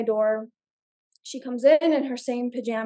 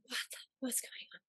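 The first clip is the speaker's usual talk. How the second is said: unvoiced, softer, fast puzzled whisper; confused whisper; 20 dB quieter, faster than usual. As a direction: hush it out half asleep. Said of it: "I'm like".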